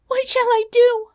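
very high, loud panicked princess; despairing cry. Acted. Princess hears lions coming nearer, high despairing cry. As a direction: wail it in desperation. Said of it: "she cried".

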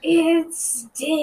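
high echo of an announcer's call; imitated yell. Co-host echoing the announcer's call, mildly imitated yell. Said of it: "stop and yell".

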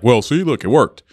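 mock a dad proud of a shortcut; smug dad. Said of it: "and be like".

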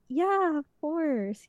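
high, reluctant fake-polite voice; forced politeness. Forced yes to an unwelcome fan.

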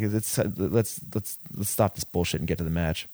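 ordinary talk

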